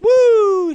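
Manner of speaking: long, high, loud whoop; imitated party-girl "woo"; bride's "woo". Bride throwing her garter, imitated high whoop. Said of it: "she takes off her garter, she's like".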